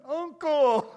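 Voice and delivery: high, loud mock scream; screaming uncle. Friend teasing the pastor about his pants, mock scream. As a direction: yell it out like crying for mercy. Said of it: "look like they're screaming".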